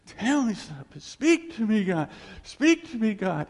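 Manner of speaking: high, loud cry to God; retold screaming. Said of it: "screaming out".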